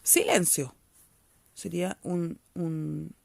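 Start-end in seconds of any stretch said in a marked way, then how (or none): 0.0-0.7 "Silencio" as one sudden loud burst of voice; exclamation. Shown as a demonstration. Teacher demonstrating an exclamation mark to pupils, exclamatory burst on "Silencio". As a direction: hit "Silencio" hard, like a command with an exclamation mark. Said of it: "como un golpe de voz".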